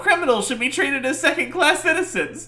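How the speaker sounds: high, loud, hammed-up outrage; ranting witness. Witness protesting his treatment in court, loud and outraged. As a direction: blow up in an indignant high-pitched rant.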